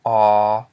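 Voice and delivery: "ah" held long and loud on one level pitch; long vowel. Shown as a demonstration. Teacher shows learners the long vowel, "ah" drawn out. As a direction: stretch out "ah" without moving the pitch. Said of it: "a long vowel".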